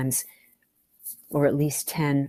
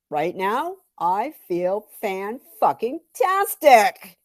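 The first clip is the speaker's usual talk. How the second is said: slow, drawn-out, higher gloating; giddy high; 4 semitones higher, much slower than usual. Acted. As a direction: savor every syllable of the sugar buzz. Sugar high boast.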